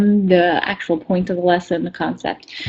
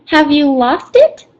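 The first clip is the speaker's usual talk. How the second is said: Piglet; 6 semitones higher, slower than usual. Acted. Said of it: "answered Piglet".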